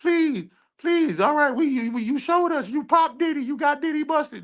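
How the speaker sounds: high, whiny mock pleading; mocking high begging voice; begging enemies. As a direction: imitate them begging for mercy, high and pleading. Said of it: "Now they begging and pleading like".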